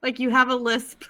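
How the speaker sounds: giggling through the words; reading a comment while laughing. Real, not acted.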